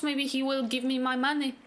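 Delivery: ordinary talk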